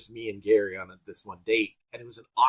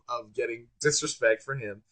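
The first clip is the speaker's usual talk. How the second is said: ordinary talk, nothing marked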